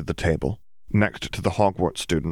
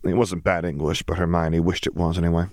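plain reading voice

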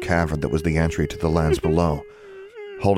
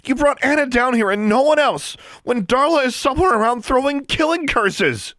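very high, raised-voice accusation; angry outburst; 5 dB louder than usual. A narrator's reading. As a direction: lash out angrily, voice climbing high. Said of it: "Her voice rose angrily".

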